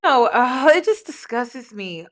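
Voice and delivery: loud, disgusted outburst; real angry outburst. Real, not acted. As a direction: blurt out real anger. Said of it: "It makes me angry".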